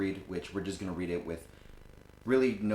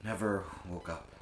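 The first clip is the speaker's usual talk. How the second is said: halting, subdued grief; acted grief; 2 semitones higher, 6 dB quieter than usual. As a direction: falter softly, as if it won't sink in. Acted monologue about friend's death.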